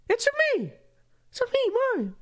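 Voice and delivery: very high squeaky Mario attempt; high cartoon plumber voice. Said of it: "that doesn't sound right".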